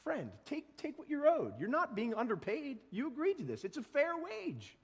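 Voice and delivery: high, gentle master reassuring the grumblers; master's voice. Acted.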